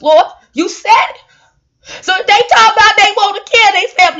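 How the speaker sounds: escalating hammed-up prayer, building from plain to high, loud preaching; comic preacher prayer rising to a fervent wail; mock prayer. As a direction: pray over them, building to a shouting cry.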